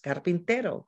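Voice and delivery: Spanish cognate, said as a model; teacher's Spanish word demo; Spanish pronunciation. Shown as a demonstration. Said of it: "similar across the languages".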